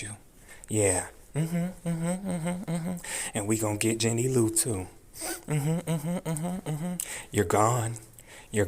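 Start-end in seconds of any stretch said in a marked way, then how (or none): 1.3-6.9 high gloating mm-hmms; gloating hums. Real, not acted. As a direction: hum it smugly up high. Gloating at the judge.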